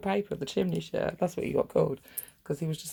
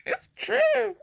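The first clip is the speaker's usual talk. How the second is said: a much higher squeaky "It's true"; squeaky quip; 6 dB louder than usual. Real, not acted. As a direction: pipe it up playfully. Closing quip after laughing.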